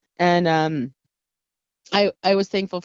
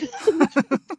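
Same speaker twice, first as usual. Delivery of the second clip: high giggle; amused laugh; 6 semitones higher than usual. Real, not acted. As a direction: laugh at yourself, pitched high. Laughs about bad accent.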